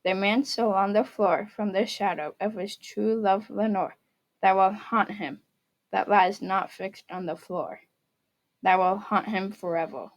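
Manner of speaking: ordinary delivery, nothing marked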